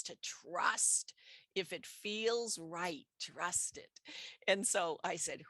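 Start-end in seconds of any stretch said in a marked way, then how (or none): none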